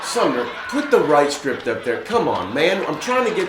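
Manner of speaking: hammed-up exasperated aside; mock exasperation. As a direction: complain to the crew mid-read. Breaks off promo, scolds script helper.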